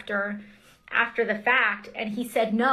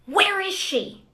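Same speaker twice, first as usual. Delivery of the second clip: raised, slow imitation of a man yelling; calling out the search, raised and slow; retold yell; 9 semitones higher, 3 dB louder, slower than usual. Congresswoman retelling an intruder's yell, raised and slow. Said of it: "I just start to hear these yells".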